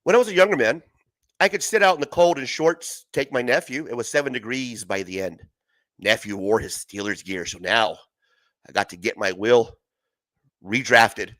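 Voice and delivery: mild mock Beckerman telling his story; Beckerman impression. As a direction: play it smart and serious, dropping low by the end. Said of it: "my best Beckerman impersonation".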